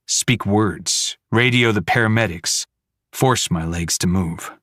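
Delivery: plain reading voice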